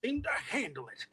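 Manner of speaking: rowdy, high-pitched fighter; Ironhide. Acted. Actor as Ironhide dismissing Deceptor creeps, rowdy voice. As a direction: scoff at the enemy, rowdy and high. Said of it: "So I would do an Ironhide".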